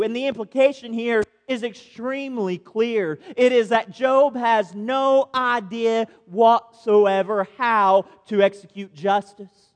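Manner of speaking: usual speaking voice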